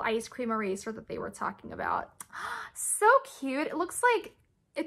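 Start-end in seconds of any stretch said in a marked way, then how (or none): 2.1-2.7 high, soft rising gasp. Real, not acted. Vlogger seeing the ice cream eraser, a soft gasp.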